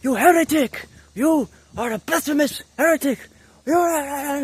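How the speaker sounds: high, loud accusing yell; full-force, high-pitched denunciation; yelling Orthodox priest. Acted. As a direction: shriek it like an outraged priest condemning a heretic. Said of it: "just yelling at me".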